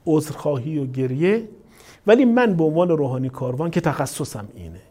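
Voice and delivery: normal talking voice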